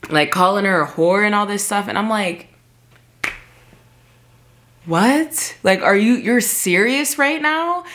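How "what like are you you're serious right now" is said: higher, disbelieving outburst; incredulous. Retold or imitated. To her drunk dad insulting his girlfriend, stunned disbelief. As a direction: hit each word with shocked disbelief.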